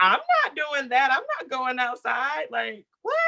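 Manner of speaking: high, whiny mock-outraged protest; indignant refusal. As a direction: refuse it like a spoiled teen. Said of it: "And I'm like".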